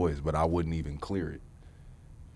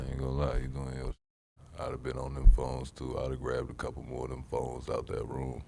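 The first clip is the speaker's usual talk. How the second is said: low, froggy movie voice; real low voice; 6 semitones lower, 4 dB quieter than usual. Real, not acted. Host praises guest's videos, real low movie voice. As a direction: drop right down low while talking. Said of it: "Buddy got real low".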